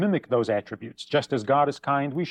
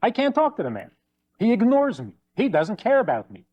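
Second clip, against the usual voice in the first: complaining wife; 6 semitones higher than usual. Retold or imitated. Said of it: "She says".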